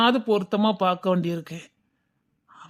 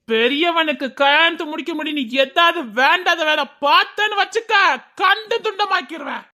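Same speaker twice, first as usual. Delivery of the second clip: high-pitched scolding, climbing steadily; angry threat; 6 semitones higher than usual. Acted. Father warning younger son off romance, angry rising threat. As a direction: warn him, rising to a strained high pitch.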